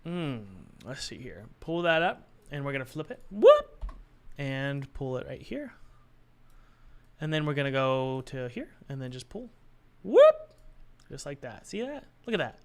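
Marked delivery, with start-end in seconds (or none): 3.3-3.8 short, high, loud whoop; playful swooping sound effect; a quick rising "whoop" as it flips over. Real, not acted. Sound effect for flipping the door. 10.0-10.5 short, high, loud whoop; playful swooping sound effect; a quick rising "whoop" as it flips over. Real, not acted.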